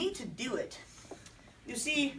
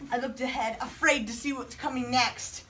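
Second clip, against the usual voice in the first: ordinary delivery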